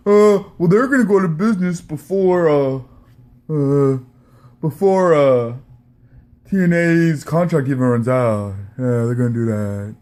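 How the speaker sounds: mocking impression, loud and halting; Don Tony. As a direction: stretch it out with dragged, dopey uhs. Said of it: "Now the T N A makes this announcement".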